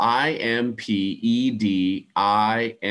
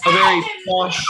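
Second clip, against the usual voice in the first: put-on posh English accent, higher and louder; mock posh British, pitched up; 6 semitones higher, 5 dB louder than usual. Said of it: "a very posh level of English".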